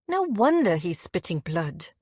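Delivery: ordinary delivery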